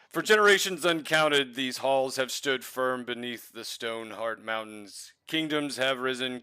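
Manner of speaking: grand royal herald proclamation; herald announcement. Acted. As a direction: proclaim it to the crowd. Herald's speech continues.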